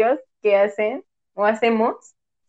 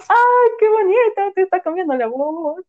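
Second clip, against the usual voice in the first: delighted and much higher in pitch; fond delight; 8 semitones higher than usual. Retold or imitated. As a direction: coo over it like it's adorable. Fondly greeting friend's changing voice.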